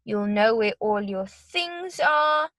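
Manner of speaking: normal talking voice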